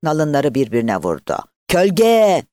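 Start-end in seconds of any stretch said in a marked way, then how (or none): 1.7-2.4 high, loud hawker's call; one long, ringing peddler's shout; street seller's cry. A narrator's reading. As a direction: call out your wares to the whole village. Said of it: "O satıcı ağzını bırakmadan".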